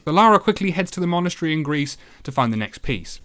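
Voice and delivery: usual speaking voice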